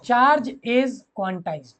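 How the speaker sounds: repeated slowly, word by word; slow dictation. Shown as a demonstration. Teacher to students, repeating the dictation slowly. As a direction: say it again slowly for notes. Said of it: "repeating dictation".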